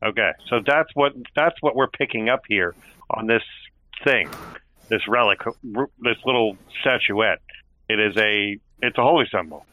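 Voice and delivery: ordinary talk, nothing marked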